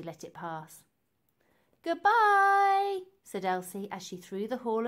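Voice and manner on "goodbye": much higher and louder, called out; a bright call over the shoulder; called goodbye. A narrator's reading. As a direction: shout goodbye while hurrying off. Elsie calls out leaving.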